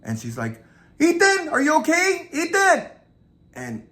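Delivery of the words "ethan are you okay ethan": high, loud worried yell; acted high-pitched frantic call. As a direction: shout up the stairs to someone in another room. Girl calls upstairs to her brother.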